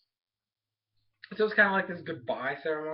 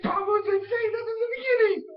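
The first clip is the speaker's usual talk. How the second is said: very high, loud crying shout at the screen; frustrated yell; 7 dB louder than usual. Retold or imitated. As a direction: yell at the show in disbelief. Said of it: "And I'm like".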